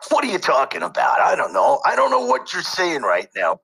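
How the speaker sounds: rough, high, shouted character bark; game character voice. Shown as a demonstration. Voice actor showing off a game character, shouting. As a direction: play a game tough guy caught off guard. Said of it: "I've done some characters".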